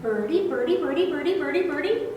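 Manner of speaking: high sing-song birdcall, watch here then birdie birdie; high lilting bird imitation for kids; cardinal call imitation. Shown as a demonstration. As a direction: mimic a cardinal's whistle with your voice. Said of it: "it sounds like it's saying".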